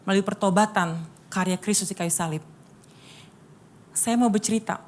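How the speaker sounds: usual speaking voice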